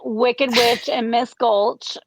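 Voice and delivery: normal talking voice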